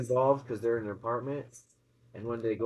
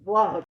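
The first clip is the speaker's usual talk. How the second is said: mock creature grunt; 7 semitones higher, 9 dB louder than usual. Monster's reaction.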